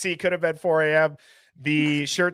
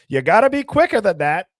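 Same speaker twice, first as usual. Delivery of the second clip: louder, higher teasing catchphrase; commercial catchphrase; 5 semitones higher, 6 dB louder than usual. Retold or imitated. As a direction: toss out an ad tagline, raised voice. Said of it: "they would just be like".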